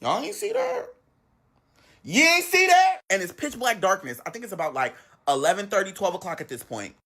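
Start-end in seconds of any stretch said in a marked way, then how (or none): 0.0-3.0 high-pitched, incredulous question; acted-out baffled alarm; startled disbelief. Looking around in the dark, startled.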